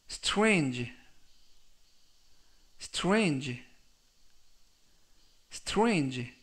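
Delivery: "strange" drawn out and falling; careful pronunciation demo. Shown as a demonstration. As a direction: model "strange" slowly for repetition. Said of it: "라고 발음하시면 되겠고요".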